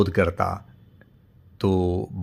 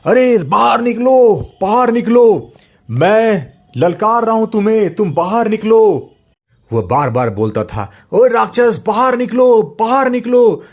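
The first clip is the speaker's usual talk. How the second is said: high, loud challenging yell; furious shout; 12 semitones higher, 8 dB louder than usual. Acted. Father shouts at monster's castle door, furious. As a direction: bellow at the monster to come out. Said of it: "जोर-जोर से चिल्लाने लगा".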